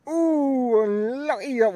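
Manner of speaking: high, loud sympathetic ooh; surprised ooh. Real, not acted. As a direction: gasp at the near miss. Reacting to a near-miss putt.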